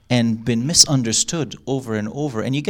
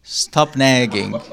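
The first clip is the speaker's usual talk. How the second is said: slow, drawn out; much slower than usual. Shown as a demonstration. Teacher giving an exercise line, drawn out slowly. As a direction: stop nagging, stretched slowly with a laugh. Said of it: "Exercise. Guess the meaning".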